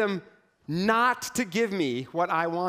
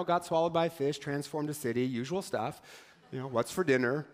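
casual shrug; 4 semitones lower, 5 dB quieter than usual. Acted. Jonah shrugs off his day.

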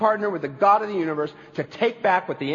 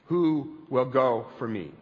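solemn call; 6 semitones lower, 4 dB quieter, slower than usual. Acted. God's call, slow and low.

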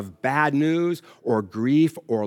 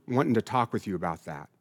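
ordinary talk, nothing marked

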